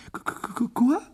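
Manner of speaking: stammering in shock, voice jumping up on quoi; bredouillement choqué. A narrator's reading. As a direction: stutter out the question, stunned. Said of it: "bredouilla madame Weasley d'une voix blanche". (bégaiement sous le choc)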